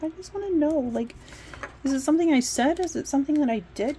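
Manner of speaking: high, pleading questions; asking the employer why she wasn't hired; pleading whine. Retold or imitated. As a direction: beg to know what went wrong.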